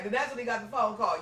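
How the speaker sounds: usual speaking voice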